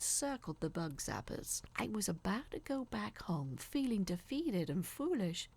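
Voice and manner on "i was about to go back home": ordinary delivery, nothing marked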